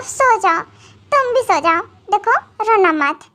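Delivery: usual speaking voice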